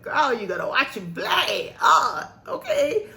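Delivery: high, loud half-crying self pep talk; a mock wail to her own heart. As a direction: cry out to yourself in a rush of nerves. Psyching herself up, crying out.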